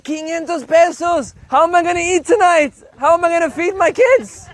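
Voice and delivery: high-pitched, hammed-up protest; exaggerated wail of disbelief; mock outrage. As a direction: plead poverty with comic despair.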